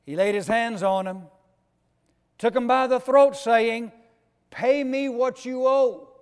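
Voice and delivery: loud, high preaching climax; fiery preaching. Acted. Preacher driving home the servant's violence, loud. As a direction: preach it hard, building.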